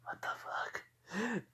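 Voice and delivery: breathy, slowed disbelief; stunned whisper. Real, not acted. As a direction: barely voice it in shock. Stunned reaction to armor.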